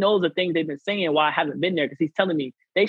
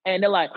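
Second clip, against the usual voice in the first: mock shocked gasp; 3 semitones higher than usual. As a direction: gasp like they can't believe it.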